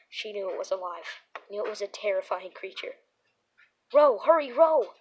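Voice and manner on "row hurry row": screamed high and loud. A narrator's reading. Donnie screaming at Becca to row. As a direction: yell it in panic, pitched up. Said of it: "Donnie's scream came out high".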